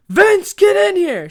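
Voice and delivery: high, loud, drawn-out angry summons; mom's scream. Retold or imitated. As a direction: scream for your kid to come inside. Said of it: "She screamed".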